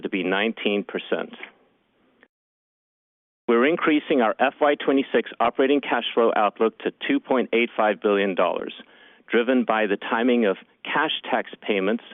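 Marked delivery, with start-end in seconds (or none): none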